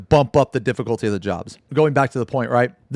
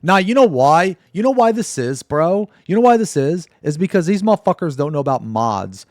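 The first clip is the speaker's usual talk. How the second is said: mocking rant; 6 semitones higher, 6 dB louder than usual. Real, not acted. Mocking explanation to chat.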